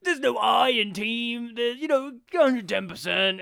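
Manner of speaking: loud, high jock drone; high-pitched dumb jock spouting clichés; dumb guy voice. Retold or imitated. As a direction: ham up a dim athlete cliché. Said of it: "he does a dumb guy voice".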